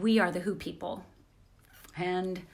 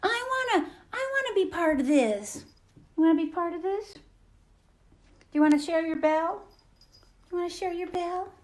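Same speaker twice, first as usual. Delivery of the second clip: squeaky pretend cat talk, pitched way up; voicing the cat; 9 semitones higher, 3 dB louder than usual. Host speaking for Jezebel trying to get on camera, high pet voice. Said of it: "she was like".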